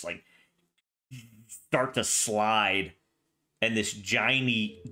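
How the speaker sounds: ordinary delivery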